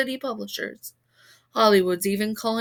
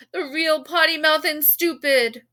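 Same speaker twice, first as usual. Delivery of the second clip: excited call; 5 semitones higher, 5 dB louder than usual. A narrator's reading. Reporter crowd calling out.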